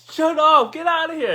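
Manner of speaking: high-pitched incredulous exclamation; excited disbelief. Retold or imitated. Friend hearing about the trip win, high incredulous disbelief. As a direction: exclaim it like you can't believe the news. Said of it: "he was, like, in disbelief as well".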